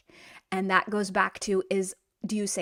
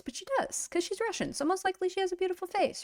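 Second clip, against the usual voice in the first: playful aside; 8 semitones higher, 6 dB quieter, faster than usual. Real, not acted. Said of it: "playful aside".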